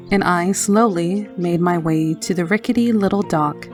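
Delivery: plain reading voice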